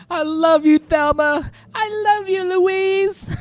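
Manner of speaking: high-pitched mock declarations of love; drawn-high parody of a tearful farewell; hammed-up movie heroines. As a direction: call it out high like melodramatic film heroines. Acting out Thelma and Louise.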